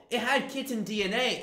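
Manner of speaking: ordinary delivery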